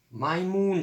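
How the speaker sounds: a high, mild storytelling shout. A narrator's reading. Nigel shouting at the others, mild shout. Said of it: "shouted Nigel".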